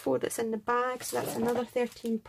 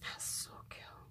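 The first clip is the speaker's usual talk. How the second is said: awed whisper; 18 dB quieter than usual. Real, not acted. Unpacking metal embellishment, awed.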